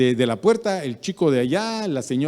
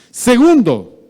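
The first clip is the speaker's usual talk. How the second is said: 1 anuncio fuerte; 10 semitones higher, 13 dB louder, slower than usual. Real, not acted. Anuncia nuevo punto.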